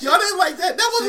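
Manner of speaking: loud, high-pitched disbelief; real outraged yelp, half laughing; incredulous protest. Real, not acted. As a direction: demand to know why nobody laughed. Protests hosts' lack of laughs.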